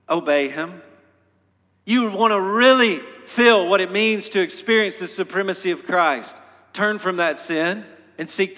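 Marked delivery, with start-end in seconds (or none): none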